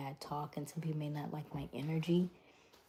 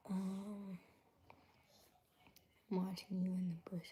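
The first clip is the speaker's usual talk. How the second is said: hushed whisper reading chat; 9 dB quieter than usual. Real, not acted. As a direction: whisper it conspiratorially.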